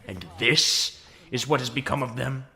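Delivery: bitter, loud indignation; angry outburst. Acted. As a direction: roar your contempt at the ruined army. Megatron rages at his failed army.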